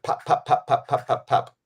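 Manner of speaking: rapid, even string of popped syllables; short percussive pops, evenly spaced; imitated paintball fire. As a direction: pepper out the pops like shots. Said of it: "And he's just like".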